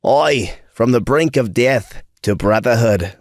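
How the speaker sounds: a lightly put-on Aussie twang, self-mocking; bad Australian accent. Narrator signing off his own story in a bad Australian accent. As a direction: ham up an Australian accent for the sign-off line. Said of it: "that's a bad Australian accent".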